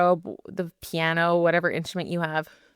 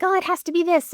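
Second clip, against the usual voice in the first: higher, tense insistence; perfectionist voice; 11 semitones higher than usual. Retold or imitated. Voicing her inner perfectionist, tense and insistent. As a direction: mimic your own perfectionism. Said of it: "perfectionism rear its ugly head".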